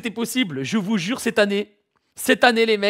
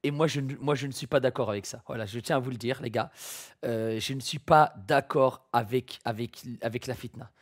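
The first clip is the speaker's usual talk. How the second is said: soft apologetic plea; 6 semitones lower, 5 dB quieter than usual. Acted. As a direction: mumble a sorry apology. Quiet fake apology video. (voix basse et penaude)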